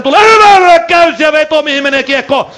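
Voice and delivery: loud, high shout of excitement; excited shout. Real, not acted. Commentator to radio listeners as the shot flies, excited shout. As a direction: explode as the shot comes.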